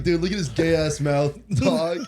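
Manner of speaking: giggling through the quote; laughing. Real, not acted. Retelling Shane's joke, laughing out loud.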